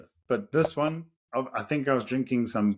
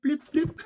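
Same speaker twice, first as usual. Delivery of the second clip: much higher, chirpy car-key beep imitation; imitated car beep. Hearing his lost car answer the key, high beep imitation. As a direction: mimic the remote locking beep. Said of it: "I did it again".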